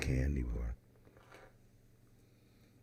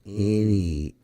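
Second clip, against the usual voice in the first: "any" punched much louder and higher; stress on any; 6 semitones higher, 12 dB louder than usual. Real, not acted. Complaining to listeners about the bare wrapper, "any" shouted. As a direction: hit "any" hard, like the last straw. Listing missing info on wrapper.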